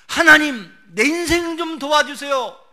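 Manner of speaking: loud, high desperate plea; crying out in prayer. Retold or imitated. Struggling believer begging God for help, crying out loudly. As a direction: shout the prayer up to heaven.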